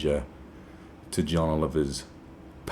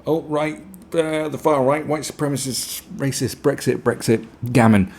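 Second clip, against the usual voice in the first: higher, buzzword-spewing mock columnist; mock SEO machine; 6 semitones higher, 4 dB louder than usual. Mocking columnists' buzzword generator. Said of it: "It's like, um".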